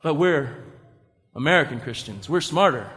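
low, soft, halting sarcasm; mock smug aside. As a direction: let the boast sink quietly, with pauses. Repeats the smug claim, deflated.

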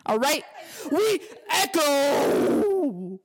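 high, slow, calling shout; loud echoing shout. Shown as a demonstration. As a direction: call it out across the hall. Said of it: "my natural self sounds like this".

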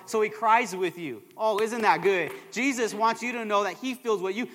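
ordinary talk, nothing marked

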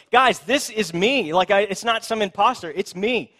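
healed beggar telling neighbours it's really him; eager insistence. Acted. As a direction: plead to be recognised, almost shouting. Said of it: "He's like".

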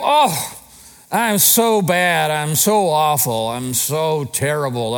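hammed-up wallowing, slow and drawn out; loud woe-is-me opening, sinking as it drags on; self-pity. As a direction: moan in mock self-pity, stretching each phrase. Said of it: "Or self-pity. You go".

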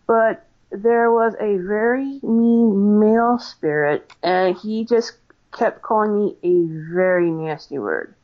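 ordinary delivery

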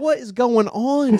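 bewildered. Retold or imitated. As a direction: stretch it out in disbelief. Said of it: "And I was like".

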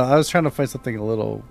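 plain reading voice

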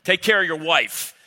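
mock yelling friend. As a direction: boom it out like a coach. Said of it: "That's how men talk to each other".

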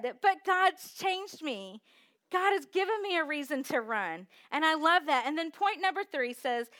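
loud, high, driving sermon voice; full-force preacher, building; preaching. Acted. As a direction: preach it out to the congregation.

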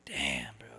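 hushed whisper. Retold or imitated. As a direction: breathe it out under your breath. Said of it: "and I was like".

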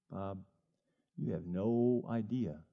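slow, low and soft, a hint of God speaking; God's voice. God gently telling Bob he has no idea, low and slow. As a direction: let it land slowly and knowingly.

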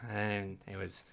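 ordinary talk, nothing marked

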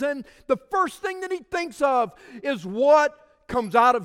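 high, loud, slowed preaching shout; fiery preaching. Acted. Pastor pressing the church about Isaiah's first words, fiery preaching. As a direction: drive the point home to the pews.

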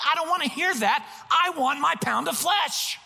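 high-pitched, petulant refusal; hammed-up angry husband; mock indignant demand. Retold angry demand to apologetic wife.

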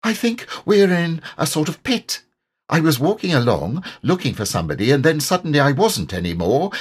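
high, piping storybook character voice; little animal recounting a mishap; small squeaky creature. A narrator's reading. Narrator voices Piglet recounting fall.

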